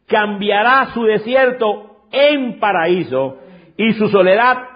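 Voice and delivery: fervent sermon reading. Acted. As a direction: lift the scripture like a preacher in full flight. Reads scripture, preaching.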